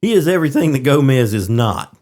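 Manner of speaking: usual speaking voice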